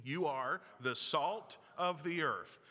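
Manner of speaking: slow, each word weighed; slow unison emphasis. Shown as a demonstration. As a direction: lead the room through "You are the salt of the earth". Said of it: "Would you say that with me?".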